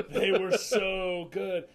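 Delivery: loud, slow savoring rave; raving. Real, not acted. Host raving about his cheeses, loud and slow. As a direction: linger on how good it was. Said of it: "rave about cheese".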